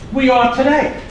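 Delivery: ordinary delivery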